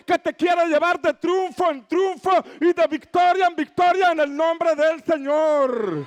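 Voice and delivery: high, rising sermon voice building to shouts; full-force preaching. Acted. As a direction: preach it out to the whole church, slow and rousing. (predicación a todo pulmón, alta y enfática)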